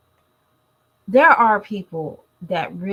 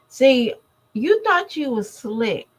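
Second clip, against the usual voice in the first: slow, pointed and higher, with attitude; sassy callout; 6 semitones higher, 4 dB louder than usual. Real, not acted. Calling out Jazz to her viewers, slow sassy taunt. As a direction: drag it out like you caught her.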